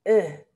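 "E" dicho entre u y e, labios redondeados; Dutch u sound. Shown as a demonstration. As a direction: sonido "E" redondeado como la u holandesa. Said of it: "Esta pronunciación es igual". (vocal "E" entre u y e)

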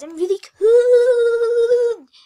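teeth-chattering, drawn-out shiver. Real, not acted. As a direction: shiver through the words, very slow. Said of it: "I'm shivering, guys".

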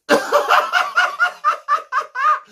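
a squealing burst of laughter; high shrieking laugh. Real, not acted. Laughing after own rant.

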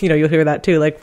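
usual speaking voice

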